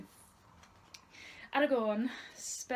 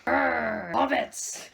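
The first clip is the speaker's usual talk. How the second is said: a hint of a gruff dwarf, louder; lightly voiced Gimli cry; 3 semitones higher, 12 dB louder, much slower than usual. A narrator's reading. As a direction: call it out as Gimli. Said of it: "cried Gimli".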